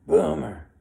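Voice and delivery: mocking drunk taunt; drunk heckler. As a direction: sneer it at the old man. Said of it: "a guy right beside me".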